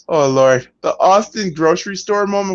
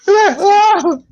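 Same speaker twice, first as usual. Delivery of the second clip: mock pain yelp; 12 semitones higher, 4 dB louder than usual. Voiced pain cry again.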